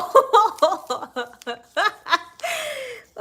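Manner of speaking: long, high, loud peal of laughter. Real, not acted. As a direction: keep laughing helplessly. Cracking up at the accent.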